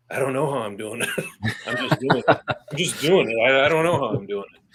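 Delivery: bemused laughing disbelief. Retold or imitated. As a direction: laugh it out, can't explain it. Retelling own baffled answer, laughing.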